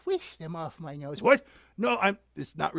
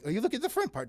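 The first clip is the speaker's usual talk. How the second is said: ordinary delivery, nothing marked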